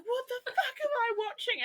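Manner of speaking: incredulous and laughing at once; laughing disbelief. Retold or imitated. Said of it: "I was just like".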